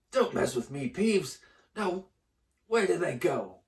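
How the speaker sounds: put-on loud, menacing old man; threatening caretaker. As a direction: threaten him. Filch threatening.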